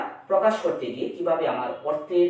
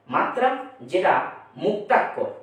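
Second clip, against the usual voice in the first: ordinary talk, nothing marked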